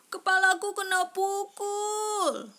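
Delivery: high, loud cry of pain; shout. A narrator's reading. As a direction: yell out after being hit. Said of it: "teriak temannya yang lain". (teriakan keras dan tinggi)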